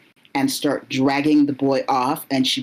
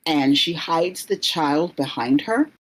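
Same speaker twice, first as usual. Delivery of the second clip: ordinary talk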